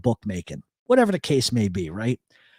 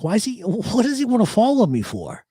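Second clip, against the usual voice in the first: mock puzzled; 8 semitones higher than usual. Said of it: "and I'm like".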